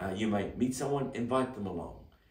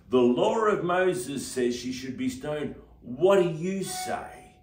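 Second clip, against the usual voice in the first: slow, loud snarl, higher pitched; snarling mob; 6 semitones higher, 7 dB louder, much slower than usual. A narrator's reading. Angry mob challenging Jesus, slow loud snarl. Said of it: "With a snarl in their voices".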